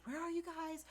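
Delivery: high anxious mock question; high-pitched imitation of a panicked friend; friend calling out. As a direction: squeak it out like a worried friend on the phone. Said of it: "She was like".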